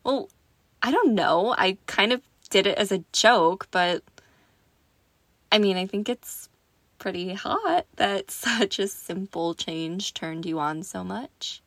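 ordinary talk, nothing marked

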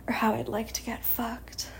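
hushed whisper. Real, not acted. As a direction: drop into a whisper. Intimate aside.

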